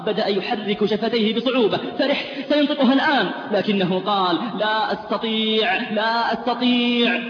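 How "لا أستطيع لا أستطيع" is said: slow, higher, sing-song lament; slow, chanted cry of despair. Retold or imitated. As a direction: drag out the helpless refusal. Dying man unable to speak.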